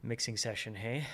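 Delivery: ordinary talk, nothing marked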